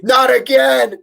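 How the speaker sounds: high, loud mock rescue cry; acted heroic shout. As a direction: shout it like a hero diving in. Acts out Andrew swinging in to rescue.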